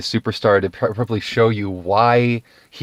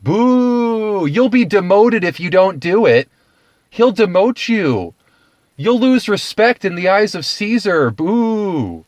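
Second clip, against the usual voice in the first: high, loud booing mob; mock crowd jeer; 10 semitones higher, 4 dB louder than usual. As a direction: heckle like a hostile rabble. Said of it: "they're all like".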